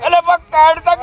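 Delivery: high, frantic cries to free his leg; panicked pleading. Acted. Stuck leg, begging his friend to pull it out in high panic.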